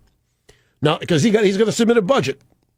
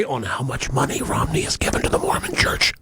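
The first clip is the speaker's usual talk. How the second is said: hushed, scandalized whisper; conspiratorial whispered reveal; mock stage whisper; 8 semitones lower, 5 dB quieter than usual. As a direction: whisper it like a shocking secret. Mock-scandalous reveal of Romney story.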